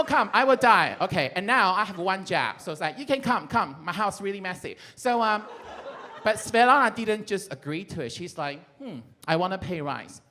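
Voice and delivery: ordinary delivery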